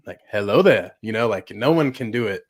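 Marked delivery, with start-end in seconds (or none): 0.3-0.9 put-on Ewan's accent. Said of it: "Ewan's accent".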